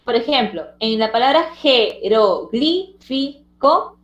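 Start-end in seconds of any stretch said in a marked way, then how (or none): 1.5-4.0 "jeroglífico" slowly split as je-ro-glí-fi-co; syllable by syllable. Shown as a demonstration. Teacher showing open syllables ending in vowels, "jeroglífico" syllable by syllable. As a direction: separate each syllable of "jeroglífico" with small pauses. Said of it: "cada una de sus sílabas".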